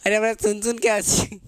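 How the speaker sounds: normal talking voice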